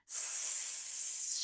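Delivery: long voiceless sss; snake hiss. Pretend. Teacher being the snake that is back, long soft hiss.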